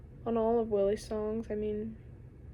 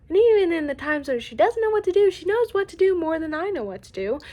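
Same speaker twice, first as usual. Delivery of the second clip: higher and louder in worry; anxious outburst; 7 semitones higher, 9 dB louder than usual. Real, not acted. Admitting sister handles it better, in an anxious rush. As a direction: pour out the worry fast.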